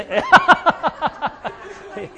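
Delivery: loud, high burst of laughter; hearty laugh. Real, not acted. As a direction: crack up mid-sentence. Joking with Trace, laughs.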